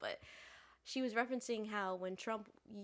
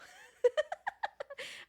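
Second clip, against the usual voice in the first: high, loud burst of laughter; 8 dB louder than usual. Real, not acted. Poet admitting the story is fake, laughing.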